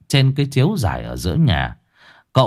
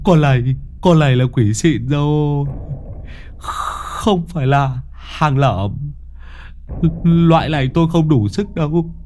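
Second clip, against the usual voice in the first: higher, nervous, comic fright; panicky babble; 6 semitones higher than usual. Acted. Môn Phọt begging to flee, higher and panicky. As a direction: babble in a jittery high voice. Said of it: "run bành bạch thều thào".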